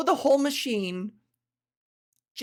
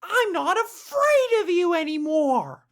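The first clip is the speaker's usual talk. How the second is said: mock defiant; 9 semitones higher, 4 dB louder than usual. Taunting the ghost, defiant.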